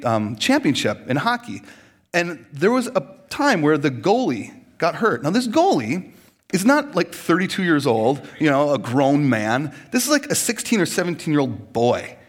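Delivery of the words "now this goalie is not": ordinary talk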